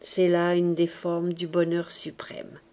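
ordinary delivery, nothing marked

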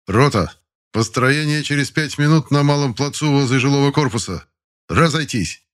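loud, high company order; shouted order. Acted. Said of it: "отдал свой приказ". (громкий приказ ротного)

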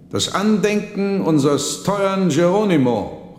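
very high, loud proclamation; monk calling out a toast to the dead; monk's loud toast. Acted. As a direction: raise the glass and call it out. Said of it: "rief er".

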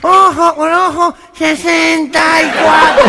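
dicho nasal, agudo y gritado; presidente gangoso. Acted. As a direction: remata el refrán como gangoso imitado. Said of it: "voy a decir un dicho". (orador gangoso proclamando a gritos)

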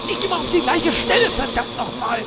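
mock-pained yelp; pained outburst. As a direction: protest through the stinging. Stung repeatedly by hornets.

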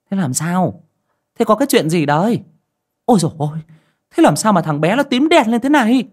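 high, loud, alarmed questions fired one after another; urgent questioning. A narrator's reading. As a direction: press for answers in a panic. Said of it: "rồi hỏi dồn".

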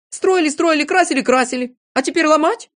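high, loud incredulous complaint; outraged at the waste; indignant protest. A narrator's reading. Тюбик protests against wrecking the tent.

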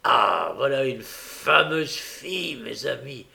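slow, savoured praise; admiring old man. A narrator's reading. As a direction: relish the praise, drawing it out.

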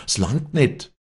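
high, loud call of alarm. Acted. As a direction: shout that the rope runs short. Rope man calls in alarm. (alarmierter Ruf)

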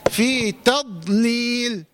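very slow تضليل, thin ت and closed heavy ض; light taa, still daad. Shown as a demonstration. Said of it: "هكذا".

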